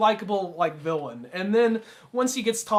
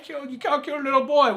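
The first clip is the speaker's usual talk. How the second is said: mock wailing quote; 8 semitones higher, 3 dB louder than usual. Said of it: "quoting the crying line".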